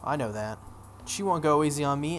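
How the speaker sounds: ordinary talk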